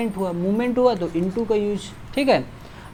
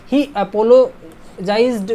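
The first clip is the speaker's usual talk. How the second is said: very slow, louder reading; slow dictation; 2 semitones higher, 5 dB louder, much slower than usual. Shown as a demonstration. Teacher reading the quiz sentence very slowly. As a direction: stretch the sentence out for students. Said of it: "apologize for होता है".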